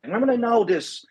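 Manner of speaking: high mock nagging question; pestering questioner. Runner nagging his pacer near the finish, mock pestering. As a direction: imitate an insistent, high-pitched question. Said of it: "after asking me about twenty times".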